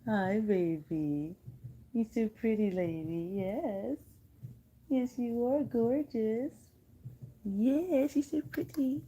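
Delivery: high, sweet pet-talk voice. Real, not acted. Cooing praise to her cat.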